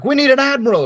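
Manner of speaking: very high, loud mock command; hammed-up Pentagon cry; mock shout. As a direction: yell it like a frantic general. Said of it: "where you think it'd be like".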